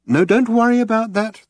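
high, sing-song reassurance; lilting. A narrator's reading. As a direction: coo it away lightly. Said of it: "the lilt continued".

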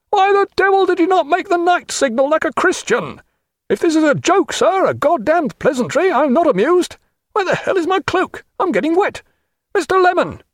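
high, blustering shouted tirade; angry senior captain. A narrator's reading. Wet senior captain scolding officers, high angry bluster.

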